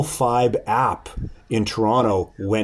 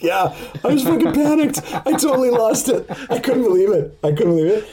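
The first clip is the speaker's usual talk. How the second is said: high, laughing confession of panic; laughing admission; 11 semitones higher, faster than usual. Retold or imitated. Admitting to friends he panicked, high and laughing. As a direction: laugh it out, pitched up. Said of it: "And I was like".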